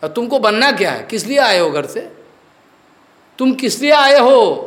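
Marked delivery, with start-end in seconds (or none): 3.4-4.6 challenging question. Real, not acted. Preacher challenges disciples' motives.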